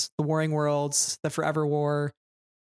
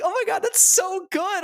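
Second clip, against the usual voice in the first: much higher, louder, gushing delight; excited squeal; 4 dB louder than usual. Real, not acted. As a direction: squeal with fangirl joy. Gushing about favorite book scene.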